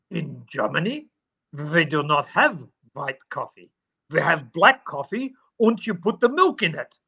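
put-on stern, deliberate German accent; mock a solid German fellow correcting a customer; imitated German accent. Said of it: "solid German fella".

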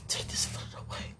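desperate hushed prayer; whisper. Real, not acted. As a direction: breathe it out as a plea.

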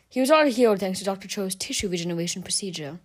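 ordinary delivery

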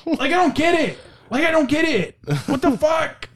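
high-pitched, worked-up disbelief; exasperated anger. Real, not acted. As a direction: get angrily baffled, voice jumping high. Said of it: "I'm, like, angry now".

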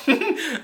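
short high laugh; real amused laugh; high burst of laughter. Real, not acted. Reviewer laughing at the car jump, high laugh. Laughs at Lady Bird jumping.

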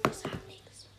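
tense hushed whisper. Real, not acted. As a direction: breathe it silently as the phone rings. Whispering as call connects.

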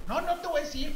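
high-pitched imitation of an outraged mom; mock a mother jumping in, voice way up; indignant mother. Other boy's mother jumping in to argue, indignant mock voice. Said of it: "Y en eso brinca mamá".

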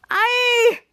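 high, loud mock shriek; hammed-up scream of alarm; acted scared scream. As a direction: yelp in put-on fright. Schwartz screaming at the crocodile.

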